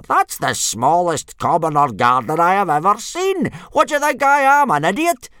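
high, loud, outraged shouting; furious blustering sergeant. A narrator's reading. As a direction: rant indignantly, climbing to a yell. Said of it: "blustered Gobber".

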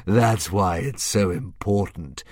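a monster musing happily on death; cheerful dragon. Acted. Dragon continues speaking.

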